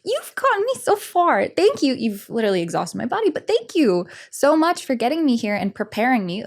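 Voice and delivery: grateful to her anxiety. Retold or imitated. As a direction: thank the anxiety like a dear friend, high. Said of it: "I was like".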